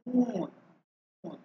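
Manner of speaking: মুন with a long, lip-rounded "oo"; rounded long vowel. Shown as a demonstration. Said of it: "ঠোঁট গোল করে উচ্চারণ হবে". (ঠোঁট গোল করে মুন)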